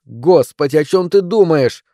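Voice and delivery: loud, high angry question; exasperated outburst. A narrator's reading. Wife challenging husband mid-quarrel, exasperated. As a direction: cry out in exasperation.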